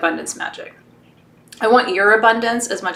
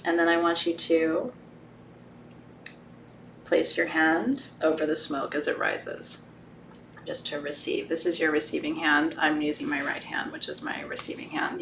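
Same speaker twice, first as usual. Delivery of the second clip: guided meditation; 4 semitones lower, 11 dB quieter than usual. Acted. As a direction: ease them gently into the ritual. Guides the smoke ritual.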